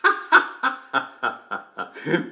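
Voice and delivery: high, breathy chuckle after the punchline; hearty laugh. Real, not acted. Host laughing at the joke he just read out, hearty laugh. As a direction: laugh at your own joke.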